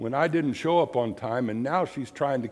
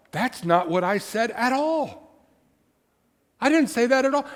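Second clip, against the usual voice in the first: indignant protest; 9 semitones higher than usual. Retold or imitated. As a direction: act baffled that they misheard you. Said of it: "And I'm like".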